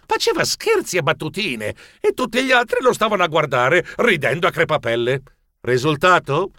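ordinary delivery

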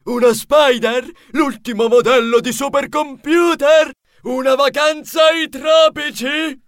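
giddy high-pitched hopeful questions; eager guessing. A narrator's reading. Same eager winner guessing his prize.

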